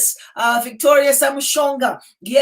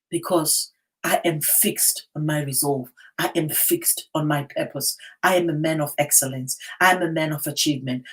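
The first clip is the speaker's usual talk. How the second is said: low, soft confessing vow; quiet declaration; 7 semitones lower, 4 dB quieter than usual. Real, not acted. Personal declaration after preaching.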